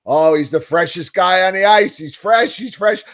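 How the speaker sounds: high-pitched gushing commentator parody; mocking the announcers. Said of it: "according to those two fucking morons".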